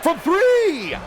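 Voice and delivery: squeaky voice crack; voice cracking up an octave. Real, not acted. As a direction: voice breaks high on the shout. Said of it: "my voice squeaked like that".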